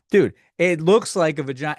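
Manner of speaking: ordinary talk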